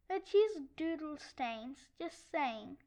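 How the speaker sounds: ordinary talk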